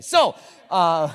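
ordinary talk, nothing marked

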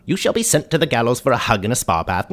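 ordinary delivery

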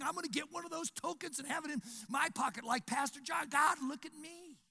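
high, whiny show-off voice; needy churchgoer. Pretend. Churchgoer pestering God to notice him, high and needy. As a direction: list your good deeds to get noticed.

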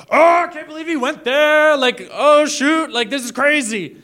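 put-on very high, loud gasping teen voice; a kid stunned in the back row; shocked teenager. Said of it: "some teenager ... going".

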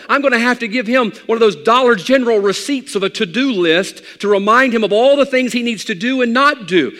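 loud, high, driving sermon climb; preaching at full force. Acted. Pastor preaching to his congregation, full force. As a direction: build it like a revival preacher.

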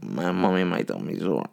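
put-on soft, whiny little child; little baby voice. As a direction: whimper it like a sick toddler. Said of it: "like, as if I'm a little baby".

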